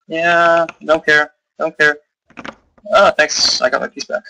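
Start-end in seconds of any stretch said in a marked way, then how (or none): none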